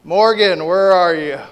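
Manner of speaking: loud call across the room. Real, not acted. Calling winner up front.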